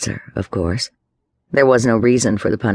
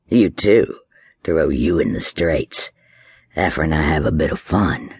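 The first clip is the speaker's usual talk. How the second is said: menacing; 5 semitones lower than usual. A narrator's reading. Drunk threatening Johanna with the straits, low and leering. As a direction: menace her with a leer.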